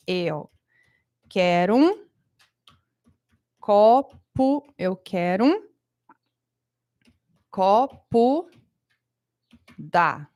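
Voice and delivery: eu quero um, pause, copo, pause; chunked phrasing. Shown as a demonstration. Teacher showing students how Brazilians chunk the phrase. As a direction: break it into eu quero um, copo, d'água. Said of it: "a gente fala".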